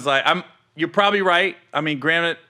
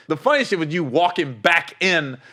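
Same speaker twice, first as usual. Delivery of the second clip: ordinary delivery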